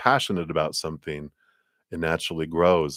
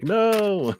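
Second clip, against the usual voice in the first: squeaky no; 13 semitones higher than usual. Pretend. Card voiced refusing again.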